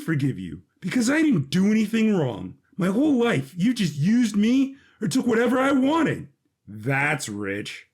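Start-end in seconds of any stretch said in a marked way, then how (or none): 0.8-6.3 heated accusation. Acted. Brothers arguing at wedding.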